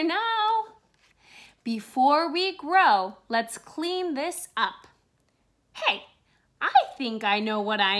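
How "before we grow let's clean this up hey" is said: ordinary delivery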